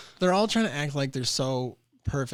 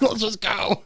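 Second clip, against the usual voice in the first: hurried dad; 10 semitones higher, 5 dB louder, slower than usual. Retold or imitated. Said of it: "He's like".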